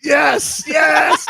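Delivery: high, loud yes yes of delight; excited cheer. Real, not acted. Listener thrilled by the quote, cheering high and loud. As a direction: cheer it like a fan.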